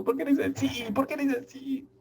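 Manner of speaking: high, mock-puzzled imitation; mocking student voice. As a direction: tease the student for sounding strange. Teacher mimics student's odd voice.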